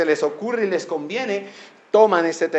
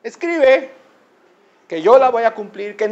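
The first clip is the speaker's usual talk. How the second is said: high, drawn-out scriptural proclamation; impassioned preaching; 8 semitones higher, 11 dB louder, slower than usual. Acted. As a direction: build to a ringing cry. Preaches scripture at full force.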